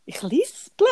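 lisple said with a lisp while laughing. Real, not acted. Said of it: "woher kommt jetzt das Lispeln".

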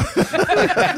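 high, loud burst of laughter; real high-pitched belly laugh; hearty laugh. Real, not acted. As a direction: crack up at your own story. Laughing at his own retold punchline.

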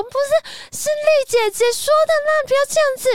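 crying and pleading. Retold or imitated. The stabbed noble lady begs Yulan, sobbing high. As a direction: beg for your life through tears. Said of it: "那个人就一边哭说".

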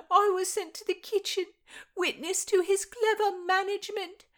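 high, loud, crying maid's voice; tearful lament. Acted. Maid weeps to her mistress over losing her love, crying. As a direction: sob out the lament.

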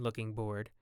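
plain reading voice

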